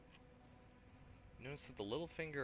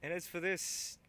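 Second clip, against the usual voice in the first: higher, louder put-on thug; 10 semitones higher, 9 dB louder than usual. Thug eyes the weapon rack, loud put-on voice. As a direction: keep the thug voice going. Thug character keeps talking.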